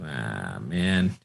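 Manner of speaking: low, quiet "ah" drawn out; reluctant groan. Acted. Head-scratching reluctance as game character.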